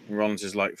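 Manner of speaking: ordinary talk, nothing marked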